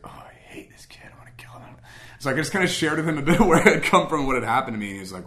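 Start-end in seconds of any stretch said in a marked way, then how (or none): 0.0-1.8 whispered aside, no voice at all; secret whisper. Retold or imitated. Telling his friend his hidden thought, whispered. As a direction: hiss the dark thought under your breath.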